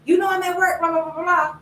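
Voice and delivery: high-pitched mock scolding; retold yelling. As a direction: re-enact snapping at your mother. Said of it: "after yelling at her".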